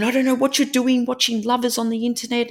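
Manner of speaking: high, hurried mock scolding; a frazzled mum telling her off; flustered mum scolding. Mum at the wheel scolding her daughter in high fluster.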